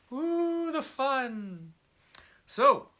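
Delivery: a high squealing ooh, then a fond the fun; giddy little aside; gleeful. Real, not acted. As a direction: savour the idea like a treat. Gleeful aside about surprise books.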